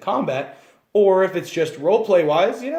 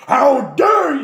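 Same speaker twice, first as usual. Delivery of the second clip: higher, loud offended outburst; angry half-orc; 10 semitones higher, 8 dB louder, slower than usual. Shown as a demonstration. Half-orc taking offense at an insult, loud and angry. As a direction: take offense and bark it back. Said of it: "you are always angry".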